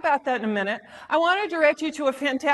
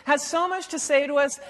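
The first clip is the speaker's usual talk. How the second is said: ordinary talk, nothing marked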